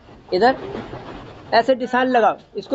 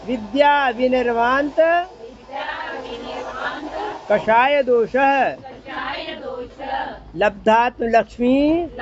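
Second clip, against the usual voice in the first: verse recitation; 2 semitones higher than usual. Acted. Teacher reciting the verse line by line for the class. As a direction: recite each phrase with long pauses.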